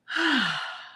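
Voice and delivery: long falling exhale through the mouth; demonstrated sigh. Shown as a demonstration. Teacher showing listeners how to exhale, an audible falling sigh. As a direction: let out a big releasing sigh. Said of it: "exhale out of the mouth with a little sigh".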